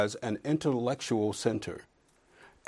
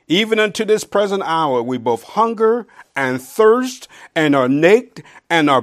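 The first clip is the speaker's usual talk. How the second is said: loud, higher, impassioned reading; preaching scripture; 6 semitones higher, 10 dB louder, slower than usual. Acted. Preacher reads Paul's sufferings to the church, loud and fervent. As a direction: build it up like a sermon climax.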